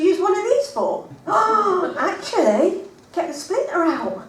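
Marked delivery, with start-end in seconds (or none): none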